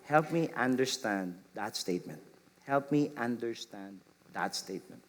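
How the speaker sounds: soft, calm and low; gentle. Shown as a demonstration. Parent gently asking a child to explain. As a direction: gently invite them to explain. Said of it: "gently say".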